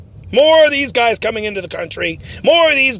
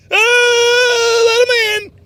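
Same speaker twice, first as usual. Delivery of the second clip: sarcastic mock; 10 semitones higher, much slower than usual. Sarcastic jab at opponents.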